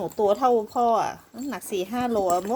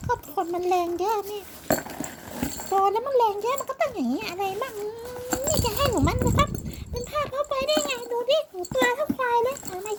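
lightly voiced pet, pitched up; pet answering mum; 13 semitones higher than usual. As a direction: talk back to mum in a higher pet voice. (เสียงพากย์สัตว์เลี้ยงตอบแม่ เสียงสูงขึ้น)

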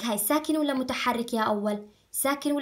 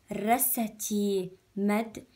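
drawn-out syllable by syllable; slow spelling drill; much slower than usual. Shown as a demonstration. Teacher drawing out the word slowly for children. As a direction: stretch each sound slowly for the pupils. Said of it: "يلا خلينا نتهجاها".

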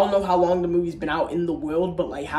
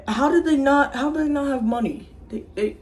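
ordinary delivery

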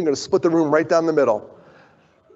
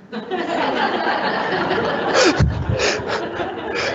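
1 burst of laughter. Real, not acted. Laughing at the sound guy.

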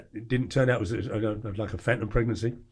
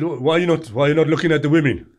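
louder, lightly put-on Danish bar voice; imitating the Danish guy; 4 semitones higher, 6 dB louder than usual. The Danish guy needling him in the bar, mock pushy voice. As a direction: lean in like a pushy drunk. Retelling the Danish guy's provocation.

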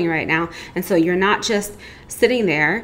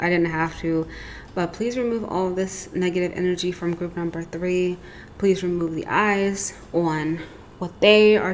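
quiet cleansing prayer; 5 dB quieter than usual. Acted. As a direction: murmur the words like a gentle prayer. Smoke cleanse, speaking words for group.

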